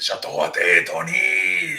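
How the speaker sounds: slow, thick mock Russian accent; Russian accent impression. Imitating a wrestler talking in his Russian accent. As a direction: drawl it out like a cartoon Russian wrestler. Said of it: "his Russian accent".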